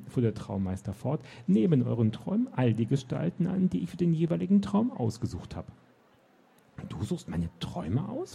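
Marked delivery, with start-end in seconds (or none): 1.5-5.7 higher, lilting self-important explanation; theatrical little courtier, voice lifted; proud dream master. A narrator's reading. 6.9-8.3 high, soft astonished question; surprised. A narrator's reading. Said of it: "fragte Henriette überrascht".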